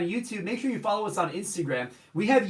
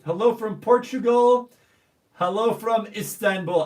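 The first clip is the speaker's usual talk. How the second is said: higher, louder hello; called greeting; 3 semitones higher, 4 dB louder than usual. Real, not acted. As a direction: call out the country. Reading live chat shout-outs.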